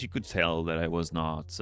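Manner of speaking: usual speaking voice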